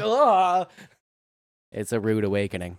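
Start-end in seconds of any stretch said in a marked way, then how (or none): 0.0-0.7 disgusted ugh. Retold or imitated. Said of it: "I'm like".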